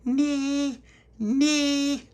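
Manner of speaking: put-on very high, loud baby squeal; baby's shout. A narrator's reading. Baby Lucia shouting through Tony's letterbox, high baby squeal. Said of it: "she shouted through the letterbox".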